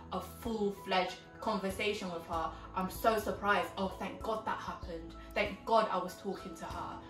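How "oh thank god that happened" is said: usual speaking voice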